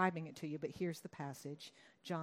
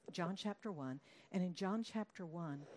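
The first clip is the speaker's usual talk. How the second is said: ordinary talk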